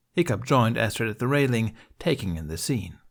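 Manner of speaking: usual speaking voice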